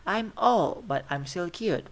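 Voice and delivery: put-on teacher's demo rise on "old"; rising intonation. Shown as a demonstration. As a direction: push "old" up high and loud, then drop back for "but I'm still cute". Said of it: "Is rising intonation".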